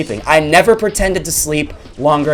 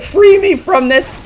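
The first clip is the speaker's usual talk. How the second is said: mock pleading; slower than usual. Begging to escape the girl.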